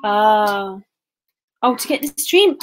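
normal talking voice

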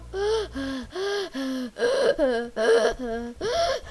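heavy, panting breaths; hard breathing. Real, not acted. Child scared after a fall tells parent, hard breathing. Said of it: "breathing hard".

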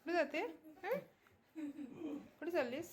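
hushed questions to the pet; soft pet talk. Real, not acted. Softly asking pet at night.

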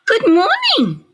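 bright high cheery greeting; friendly ladybug voice. A narrator's reading. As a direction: sing out a sweet hello. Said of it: "said the friendly ladybug".

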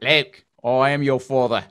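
slow mock-Cockney movie villain; bad Cockney accent. Host imitating the guy behind Darth Vader, bad Cockney accent. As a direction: attempt Cockney, drifting towards Australian. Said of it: "apparently had a Cockney accent".

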